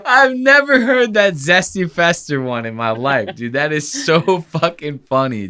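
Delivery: high, helpless laughing voice; cracked up and marvelling; real laughter. Real, not acted. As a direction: talk through the giggles, settling gradually. Cracking up at the clip.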